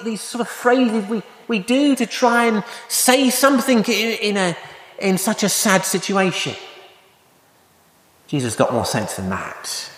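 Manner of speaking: normal talking voice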